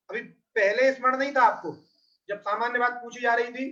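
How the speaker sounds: ordinary delivery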